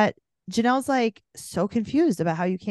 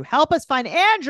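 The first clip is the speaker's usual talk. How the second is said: Andrew called out higher and louder; louder appeal; 8 semitones higher, 6 dB louder than usual. Real, not acted. Host pleading with listeners to find Andrew, called out louder. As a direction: call it out to the audience.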